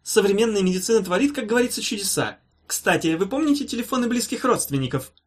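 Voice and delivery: high-pitched, emphatic doctor patter; breezy professor. A narrator's reading. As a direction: chivvy the patient along grandly. Professor falsely cheering the patient.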